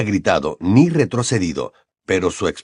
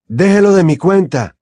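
higher, louder, eager volunteer; young man offering at once; 9 semitones higher, 6 dB louder than usual. A narrator's reading. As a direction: jump in keen to help. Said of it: "dijo Bill".

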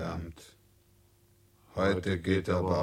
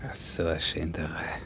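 unvoiced whisper, sighing complaint; a hushed, weary whisper; whispered grumble; 6 semitones lower, 7 dB quieter than usual. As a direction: mutter it to yourself under your breath. Grumbling aside under breath.

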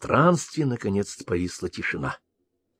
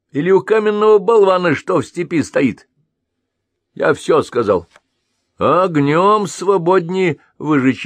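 high, loud scornful rebuke; sharp retort; 10 semitones higher, 5 dB louder, slower than usual. Acted. Elder snapping at his lounging second self. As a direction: cut him down in scorn. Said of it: "обрезал его Большеголовый".

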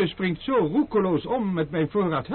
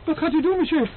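frightened; 8 semitones higher, 4 dB louder, faster than usual. Acted. Nervous servant before storming in.

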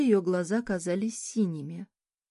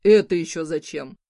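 louder, indignant rector; 9 dB louder than usual. A narrator's reading. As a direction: demand it with indignation. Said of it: "возмущённо спросил".

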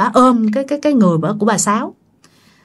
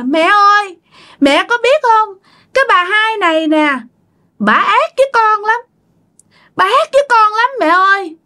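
put-on high, plaintive little-child voice; possessed child voice; 8 semitones higher than usual. Dead child accusing Bà Hai to mother, child voice. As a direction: complain to mother like a wronged child. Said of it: "như một đứa con nít".

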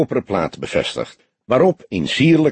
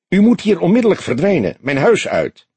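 much higher, louder and fast, ordering them out; commanding order; 9 semitones higher, 3 dB louder than usual. A narrator's reading. Woman ordering the detectives out of her house, commanding. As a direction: throw them out of your house in one burst.

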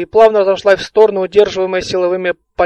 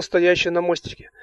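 ordinary delivery, nothing marked

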